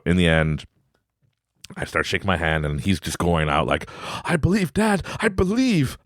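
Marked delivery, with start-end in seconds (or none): 4.2-6.0 put-on higher, earnest kid voice; eager son. Son insisting to dad, higher eager child voice. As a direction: plead like a boy sure of magic.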